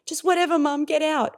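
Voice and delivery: put-on high, loud brush-off teenager voice; dismissive teen. Seventeen-year-old daughter dismissing her mum, high and loud. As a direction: snap it like a teen shooing mum away. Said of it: "which can be".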